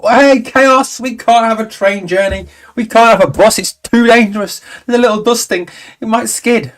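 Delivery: sarcastic high-pitched parody of a timid complainer; mock panic. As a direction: squeal it like a fussy overreactor scared of a light dusting. Mocking people panicking over snow.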